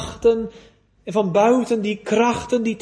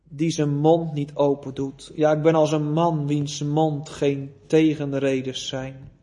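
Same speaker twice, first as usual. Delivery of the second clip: scripture reading; 5 semitones lower than usual. Acted. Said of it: "Het veertiende, vijftiende vers".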